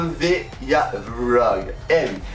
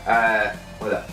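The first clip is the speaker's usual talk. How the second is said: talking with tongue stuck out; tongue sticking out; 4 semitones lower than usual. Real, not acted. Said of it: "vient de te tirer la langue". (langue tirée)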